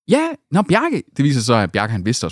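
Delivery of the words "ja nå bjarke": high-pitched retold voice; imitated door answer. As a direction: play the person answering the door, voice lifted high. (efterlignet, lys stemme)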